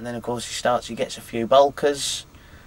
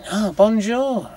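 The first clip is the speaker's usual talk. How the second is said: high, drawn-out un-French bonjour; Englishman's French, pitched up and stretched; unconvincing French accent; 8 semitones higher, slower than usual. Retold or imitated. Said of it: "That doesn't sound like a French accent".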